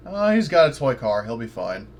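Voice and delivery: usual speaking voice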